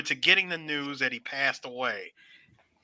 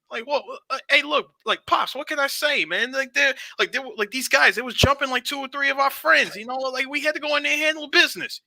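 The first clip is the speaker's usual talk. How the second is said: sheepish son; 8 semitones higher than usual. Retold or imitated. Son excusing himself to dad.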